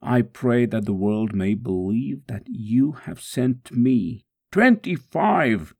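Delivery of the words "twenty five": slow, high-pitched cry; drawn-out pained cry; pained count. Acted. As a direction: cry out the count as the lash lands. Counting lashes during the scourging.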